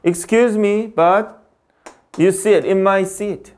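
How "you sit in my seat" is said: slow dictation. Shown as a demonstration. Teacher dictating example sentence.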